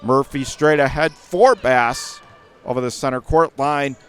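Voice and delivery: ordinary talk, nothing marked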